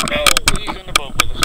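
high, loud, thrilled cry; real burst of excitement; excited yelp. Real, not acted. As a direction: shout it as the fish lands. Fish lands in boat.

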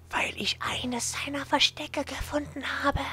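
slow, high secret whisper. Acted. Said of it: "flüstert noch leiser".

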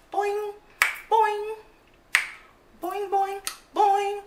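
high, bouncy cartoon boing; playful boing noise. Vlogger asking viewers for a thumbs up, playful high boings. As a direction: imitate a springy button with high boings.

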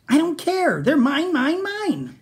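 high, loud furious dino yell; greedy yell. Acted. Minosaur yells at classmates who ask him to share. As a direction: shout down the others, no sharing. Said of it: "the Minosaur yelled".

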